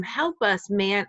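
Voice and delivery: ordinary delivery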